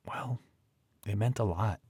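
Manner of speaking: a breathy whispered "well", voice catching; hushed well. Retold or imitated. Daughter recalling her mother's tears, whispering softly. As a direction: let the word fall to a whisper, moved.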